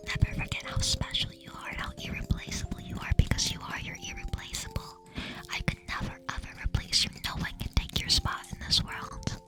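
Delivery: close-mic whispered ASMR, soft and tender. Acted. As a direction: murmur tingles right into the mic.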